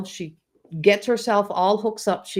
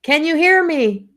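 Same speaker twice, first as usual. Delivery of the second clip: put-on high, loud child calling out; little child's voice; 8 semitones higher, 6 dB louder than usual. Grandma imitating her toddler granddaughter calling from across the church, high little voice. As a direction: call out like a tiny kid across a hall. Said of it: "I can hear this little voice going".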